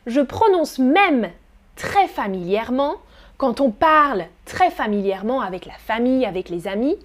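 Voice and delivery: ordinary delivery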